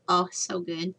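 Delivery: talking while chewing sausage; words round a bite of food; mouth full. Real, not acted. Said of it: "I can talk with my mouth full".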